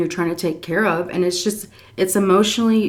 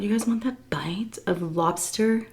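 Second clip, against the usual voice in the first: soft whisper; whispered offer; 2 semitones higher, 8 dB quieter than usual. Real, not acted. To viewers offering lobster dip, whispered. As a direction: tempt them quietly. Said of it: "whispered offer to viewers".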